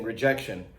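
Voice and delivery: ordinary talk, nothing marked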